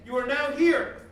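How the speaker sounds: ordinary delivery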